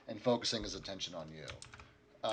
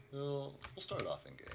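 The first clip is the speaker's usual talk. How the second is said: normal talking voice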